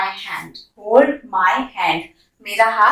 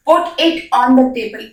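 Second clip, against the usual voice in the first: slow model sentence; much slower than usual. Shown as a demonstration. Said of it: "model sentence slowly".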